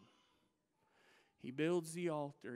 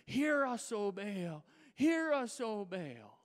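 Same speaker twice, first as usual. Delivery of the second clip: hollering to Baal; 3 semitones higher, slower than usual. Retold or imitated. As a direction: cry out desperately to a silent god. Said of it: "they begin to throw themselves on the altar".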